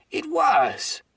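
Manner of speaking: high, loud excited burst; excited cry. Acted. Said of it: "cried Silver, now quite excited".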